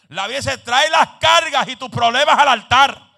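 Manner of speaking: loud, high-pitched sermon shout; full-force preaching. Real, not acted. Preacher urging the congregation to bring burdens to the altar, full-force preaching. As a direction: drive each promise home at full volume.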